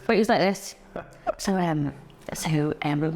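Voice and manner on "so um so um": hushed imitation of an awkward friend; mock whisper. Friend mimicking a spaced-out mate's small talk in a whisper. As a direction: breathe it out like a nervous stoner.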